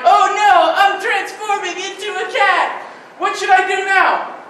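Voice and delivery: light mock panic; mock panicked character. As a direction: play the startled cat-person. Character transforming into cat.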